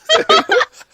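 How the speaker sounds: high, loud burst of laughter. Real, not acted. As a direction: burst out laughing at your own tease. Laughs after teasing the co-host.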